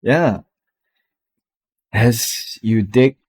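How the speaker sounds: usual speaking voice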